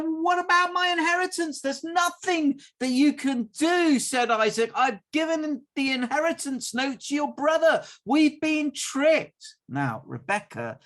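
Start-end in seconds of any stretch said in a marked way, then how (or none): 0.0-9.5 dismayed shout. Acted. Esau and Isaac realise the trick.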